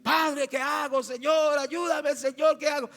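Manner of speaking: high, loud, frantic-sounding mock plea; wrong way: shouted prayer. Shown as a demonstration. Preacher showing how not to pray to Father, high shouting. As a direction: belt the plea at the top of the voice. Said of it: "entonces no le va a decir".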